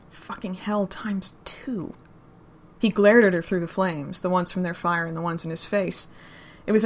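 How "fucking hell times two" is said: slow, hushed exasperated swear; a drawn-out whispered oath; slow whispered curse. Acted. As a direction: whisper it slowly, doubly dismayed. Daryl's dismayed reaction.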